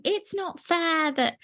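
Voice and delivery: put-on high whiny kid voice; childish whine. To her parents, a high childish whine about fairness. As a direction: complain like a five-year-old. Five-year-old self whining about fairness.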